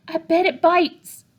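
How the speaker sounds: put-on high, slow little girl voice; a small girl's nervous guess; child voice. A narrator's reading.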